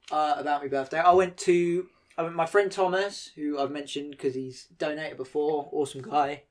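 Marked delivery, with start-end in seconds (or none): 5.7-6.4 real crack in the voice, unplanned. Real, not acted. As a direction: voice breaks on awesome guy. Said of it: "Oh, my voice broke like that then".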